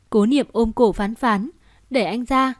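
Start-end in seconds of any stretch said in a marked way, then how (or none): none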